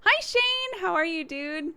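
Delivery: high, bright hello to a friend; squeaky cheerful hi; excited greeting. Real, not acted. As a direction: call out happily to a friend.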